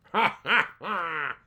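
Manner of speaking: gloating laugh. Acted.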